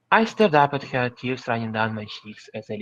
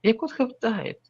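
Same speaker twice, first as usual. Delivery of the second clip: sobbing; 11 semitones higher than usual. Acted. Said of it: "I sobbed".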